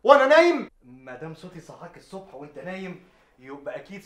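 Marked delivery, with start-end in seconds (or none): 0.0-0.7 high, loud exasperated protest; angry outburst. Acted. Man woken at ten complains to brother, loud and angry. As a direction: snap back in disbelief.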